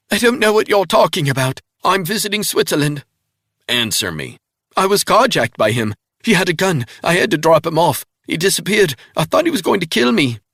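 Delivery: high, loud, fast frightened denial; wounded woman lying desperately; panicked English woman. A narrator's reading. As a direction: rush the lie out in fear. Woman's held higher voice.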